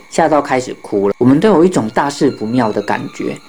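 ordinary delivery, nothing marked

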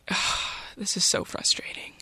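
exasperated whisper under the breath; frustrated whisper. Retold or imitated. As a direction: whisper it to yourself, fed up.

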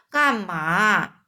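slow drowsy half-awake question; just woken, drag it out; sleepy mumble. Acted. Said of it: "睡眼惺忪问". (睡眼惺忪地慢慢问)

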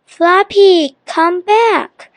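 high-pitched yell after the dog. A narrator's reading. Biff yells after the dog running away. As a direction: call the runaway back, high. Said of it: "yelled Biff".

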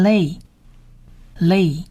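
"lay" drawn out slowly as lei; Hebrew syllable model. Shown as a demonstration. Teacher joins consonant and vowel for learners, slow syllable model. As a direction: say "lay" slowly, pausing before the repeat. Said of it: "我们是发成A的音".

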